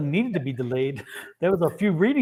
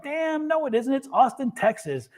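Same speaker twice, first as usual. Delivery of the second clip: mock indignation; 8 semitones higher than usual. Retells own protest about Russian accusation.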